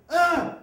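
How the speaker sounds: loud high yelp, hammed-up; faked pain yell. Boy with book in pants fakes a yell for the nun, loud. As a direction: fake a howl though nothing hurts. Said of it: "and I went".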